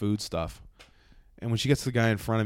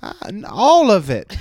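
high, loud, drawn-out demand; slow put-on voice of the pushy shopper; imitating stubborn woman; 9 semitones higher, 11 dB louder, slower than usual. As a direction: mimic her refusing to budge. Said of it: "She goes".

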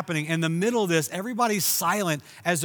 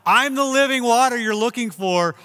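higher and louder, a full preached cry; hollering out; 6 semitones higher, 7 dB louder than usual. Retold or imitated. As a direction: holler it out over the whole crowd. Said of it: "Jesus hollers out".

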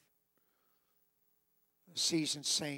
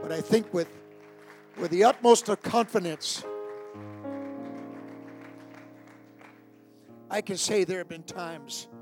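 preaching surge; 3 semitones higher, 8 dB louder than usual. Acted. Preaching surge over music.

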